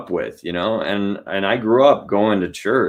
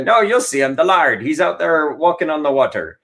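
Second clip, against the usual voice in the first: put-on pirate accent; 6 semitones higher than usual. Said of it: "they go".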